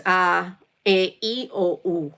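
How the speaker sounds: A, E, I, O, U as ah, eh, ee, oh, oo; pure Filipino vowels. Shown as a demonstration. Teaching a learner how to pronounce the vowels, pure Filipino vowels. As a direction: say A as open "ah", each of A, E, I, O, U a single pure vowel. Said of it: "A is always A".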